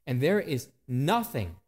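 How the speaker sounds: normal talking voice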